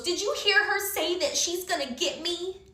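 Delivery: high-pitched whiny tattletale imitation; mock tattling. Mocking Melvin tattling to the judge.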